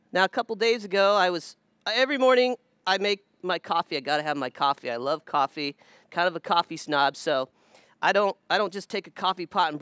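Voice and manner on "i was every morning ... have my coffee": usual speaking voice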